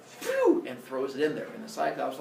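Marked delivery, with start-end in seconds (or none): 0.0-0.6 high, loud whistling whoosh; throwing whoosh. Acted. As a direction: imitate something flung down a hole. Imitates metal being thrown.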